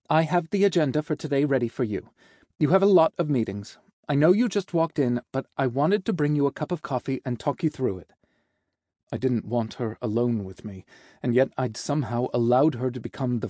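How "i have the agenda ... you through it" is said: higher, brisk, helpful secretary voice; bright secretary. Acted. Secretary briefing the boss on the day, bright and helpful. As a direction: lift it into an eager assistant's briefing.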